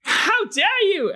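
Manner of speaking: high, slow outraged rogue. Acted. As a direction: cry out accusingly in a high voice. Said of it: "the rogue is like".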